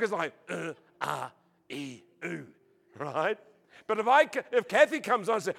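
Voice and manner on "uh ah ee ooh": low, soft male grunts; mock inarticulate man grunting; caveman-like grunts. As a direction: grunt single vowels in place of talk. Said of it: "That's how we talk".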